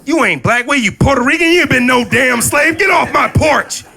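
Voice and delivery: high, loud shouted scolding; mock furious lady. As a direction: yell her off the porch. Angry lady yells at kid.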